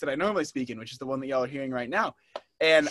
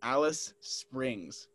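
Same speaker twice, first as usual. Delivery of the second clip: "Alice Springs" very slow and soft; "Alice Springs" drawn out, lower in volume; slow clarification; 3 semitones lower, 8 dB quieter, much slower than usual. Pretend. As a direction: repeat "Alice Springs" slowly, word by word. Repeats answer after "Who?.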